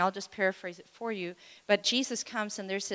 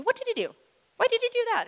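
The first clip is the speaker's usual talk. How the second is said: mock interrogators; 13 semitones higher, 4 dB louder, faster than usual. Pharisees grilling the healed man.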